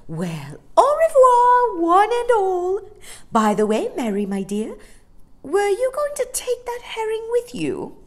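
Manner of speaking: high, loud sing-out farewell; Amelia the hearty dolphin. Acted. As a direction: call goodbye grandly, then ask keenly.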